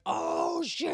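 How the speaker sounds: very high, loud drawn-out holler; hooting group reaction. Retold or imitated. Cops hearing their friend get roasted, hooting holler. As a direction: howl like a crowd hearing a burn.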